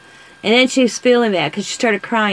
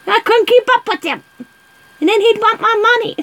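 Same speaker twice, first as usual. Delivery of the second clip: high, louder, hammed-up old lady; high cackly granny voice, louder; sassy old lady; 12 semitones higher, 4 dB louder, faster than usual. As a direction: play the feisty ninety-year-old, pitched way up. Voicing the sassy old aunt.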